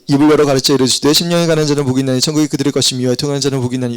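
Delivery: fast gabbled Bible reading; rapid reading. Shown as a demonstration. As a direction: race through the verses like a pastor on autopilot. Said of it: "이런 식으로 읽어요".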